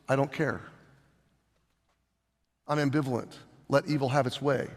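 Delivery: indifferent shrug. Pretend. An uncaring God voiced to show indifference, flat dismissive mumble. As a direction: let it drop as if nothing matters.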